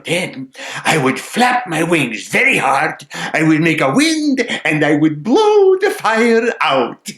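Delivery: high, loud sparrow, building up; plucky sparrow. Acted. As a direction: build to a triumphant bird answer. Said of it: "said the sparrow".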